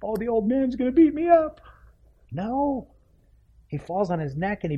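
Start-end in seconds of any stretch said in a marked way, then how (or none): 0.0-2.9 much higher, pinched mock panic; fearful son. The prodigal son sees his father running, mock fright. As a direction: squeak it like a boy expecting a beating. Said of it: "he sees his dad running toward him".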